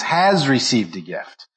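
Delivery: "has" hit firmly, slow delivery; stress on has. Shown as a demonstration. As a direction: punch "has", then finish the phrase slowly. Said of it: "the emphasis is has".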